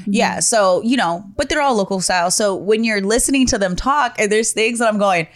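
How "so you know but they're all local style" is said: normal talking voice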